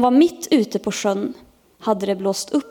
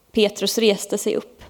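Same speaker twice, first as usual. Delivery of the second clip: ordinary delivery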